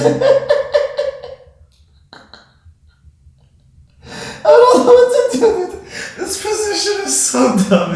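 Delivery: high-pitched through laughter; laughing. Real, not acted. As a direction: squeak out the words laughing.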